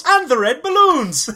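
high, loud mock Irish; hammed-up Irish accent; leprechaun impression. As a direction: cap the list with a big leprechaun cry. Finishing the leprechaun catchphrase.

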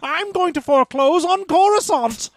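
very high, hammy villain voice; sneering panto villain. Acted. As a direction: gloat over the foreclosure. Villain bit continues.

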